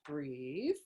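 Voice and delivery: low, long-held word with a rising glide; calming instruction. Retold or imitated. Therapist jokingly settling her panicked clients, mock soothing. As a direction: draw it out softly, as if slowing someone's panic.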